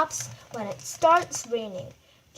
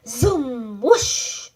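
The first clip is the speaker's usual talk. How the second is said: whooshing sound effect; 7 dB louder than usual. Acted. Crowd rushing past.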